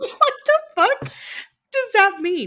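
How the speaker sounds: high baffled outburst. Real, not acted. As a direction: squeal it, totally confused.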